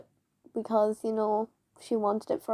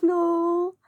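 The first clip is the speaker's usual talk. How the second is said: a sharp high refusal, raised voice; loud high no; 7 semitones higher, 4 dB louder than usual. Retold or imitated. Child refusing teacher, sharp high loud no. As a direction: shout no at the teacher, pitched high.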